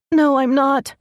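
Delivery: high, loud sobbing protest. Acted. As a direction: sob the denial. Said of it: "she sobbed".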